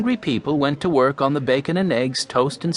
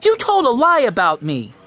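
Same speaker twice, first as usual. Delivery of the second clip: high, louder accusation; angry yell; 10 semitones higher, 5 dB louder than usual. A narrator's reading. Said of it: "yelled Mike".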